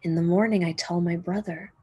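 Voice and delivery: usual speaking voice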